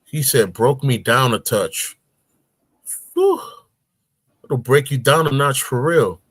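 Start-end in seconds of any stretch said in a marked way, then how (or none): none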